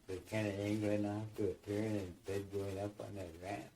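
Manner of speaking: ordinary talk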